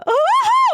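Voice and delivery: high, loud whooping laugh; gleeful whoop. Real, not acted. Gleeful reaction to diss track.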